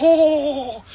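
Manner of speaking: long, high held "oh"; mock groan. Kid watching his brother suffer through squats, high drawn-out groan. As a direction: draw out a high-pitched dismayed "oh". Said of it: "and the heavy breathing, and I was like".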